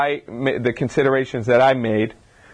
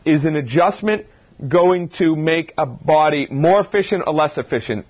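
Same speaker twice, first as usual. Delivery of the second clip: normal talking voice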